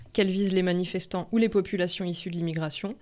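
usual speaking voice

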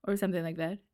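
ordinary talk

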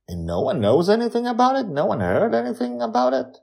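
put-on high, fast boy questioning; a boy pressing eagerly; curious boy. A narrator's reading. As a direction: Charlie asks, high and quick. Charlie voiced, very high.

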